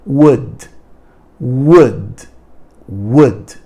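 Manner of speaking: "Would" like two U's, second stronger, tiny D; careful pronunciation. Shown as a demonstration. Teacher showing students the sound of would, slow and clear. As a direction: glide from a weak u into a strong u, end on a faint D. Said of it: "Olha a pronúncia".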